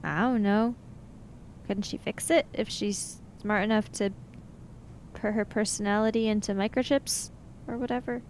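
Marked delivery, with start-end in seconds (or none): none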